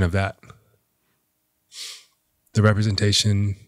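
slow, halting words through rising tears; holding back tears while thanking a friend; choked up. Real, not acted. Speaking of his late father and Carlos, slow and choked up. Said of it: "I'm getting choked up again".

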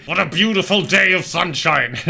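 forced cheer through clenched teeth; through gritted teeth. Acted. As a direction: grit your teeth and fake cheer. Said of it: "said Hermit through gritted teeth".

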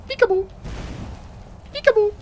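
high teasing sing-song peekaboo; mock peekaboo taunt. Gamer teasing the boss from behind cover, high sing-song. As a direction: tease the boss like a child playing hide and seek.

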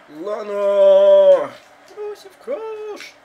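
excited yell. Real, not acted. Shouting at a finishing move.

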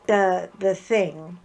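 normal talking voice

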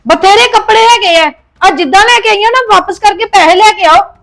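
angry order. Acted. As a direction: order her to take them back.

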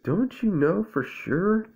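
high, slow exasperated question; exasperated Owl. A narrator's reading. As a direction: press Otter, drawn out and high. Owl presses Otter, irritated.

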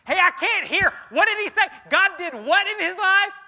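hammed-up high and loud voice of a lady who can't follow the sermon; confused lady at the back. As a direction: play a woman in the back who can't hear. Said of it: "a lady from the back goes".

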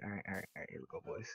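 very soft, low mutter; muttered readying. Real, not acted. Gamer readying for the fight, low mutter. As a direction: say it under your breath, low and quiet. Psyching self up before fight.

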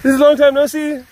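very high, loud gushing hello; excited greeting. Retold or imitated. The pet greeting her pal, high and overjoyed. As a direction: greet an old friend ecstatically. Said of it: "Noe's just like".

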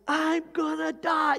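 high, drawn-out cry of terror; mock panic. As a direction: act out a panicking passenger, stretching the words. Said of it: "they're paralyzed with fear".

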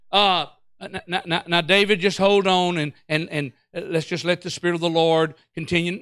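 low, hesitant, placating murmur; gentle hedging. Pretend. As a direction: ease him down politely, stumbling a little. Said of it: "He didn't say".

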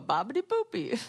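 put-on light bouncy Italian accent on "Babidi Boopy"; a playful jokey Italian "Babidi Boopy"; mock Italian accent. Friend laughing at a restaurant name in a light Italian accent. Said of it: "a joke of an Italian accent".

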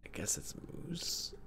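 hushed whispered accusation. Real, not acted. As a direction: breathe it out, unvoiced. Quiet suspicious accusation.

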